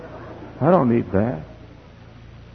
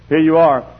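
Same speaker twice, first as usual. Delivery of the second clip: ordinary talk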